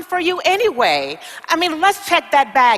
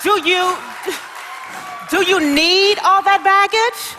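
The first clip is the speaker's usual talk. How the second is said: incredulous; 6 semitones higher than usual. Acted. Agent incredulous at baggage.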